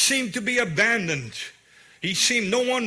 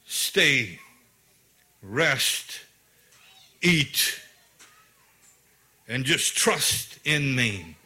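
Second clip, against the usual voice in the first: God speaking gently; 7 semitones lower, much slower than usual. Retold or imitated. God telling a restless Elijah to rest, low and gentle. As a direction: let each word settle like a calm command. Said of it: "And God is saying".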